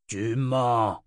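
mildly angry accusation; understated tyrant's contempt; spat out. A narrator's reading. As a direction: spit it at her, restrained. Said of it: "cracha Jagang".

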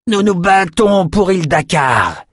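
high, slow battle declaration; defiant declaration. Acted. Bald veteran challenging the rebels, defiant. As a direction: proclaim the cause, drawing it out.

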